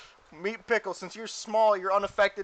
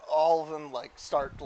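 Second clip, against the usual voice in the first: normal talking voice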